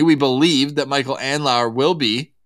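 usual speaking voice

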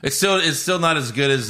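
ordinary delivery

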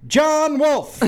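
announcer calling the name to the crowd; grand stadium announcer. Retold or imitated. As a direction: boom it out over the stadium. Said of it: "I asked him to say this".

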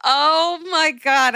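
drawn-out, high-pitched amazement; amazed gasping reaction. Real, not acted. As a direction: stretch it out in real astonishment. Co-host reacts to celebrity reveal.

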